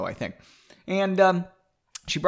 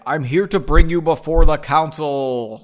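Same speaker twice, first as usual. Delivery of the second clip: a touch louder, a lightly voiced council messenger; lightly voiced official; 7 dB louder than usual. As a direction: announce it like a summoning herald. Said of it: "I'm doing voices".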